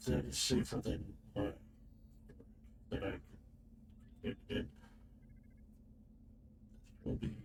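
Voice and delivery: choppy, gated mic audio; noise reduction artifacts. Real, not acted. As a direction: voice cut out by noise suppression. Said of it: "I think your noise suppression is cutting you off".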